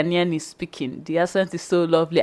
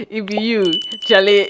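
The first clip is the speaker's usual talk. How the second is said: imitating Ghanaian pidgin; 2 semitones higher, 5 dB louder than usual. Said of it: "they say".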